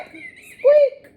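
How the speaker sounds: high loud toy squeak; rubbery high squeaky toy. Acted. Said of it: "says the little rubber duck".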